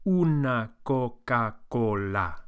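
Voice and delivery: "Una Coca-Cola" slowly, every vowel pure. Shown as a demonstration. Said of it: "Really try to get those pure vowels".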